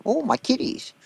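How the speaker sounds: high, soft doting whine over his kitties; Bubbles impression. Friend imitates Bubbles mourning his kitties, soft high whine. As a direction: coo it like a lonely man missing his cats.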